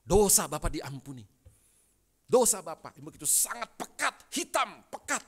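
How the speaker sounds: loud, high, climbing preacher's emphasis; emphatic exhortation. Retold or imitated. Preacher evangelizing the sick child's father, forceful and high. Urging the father about forgiven sin.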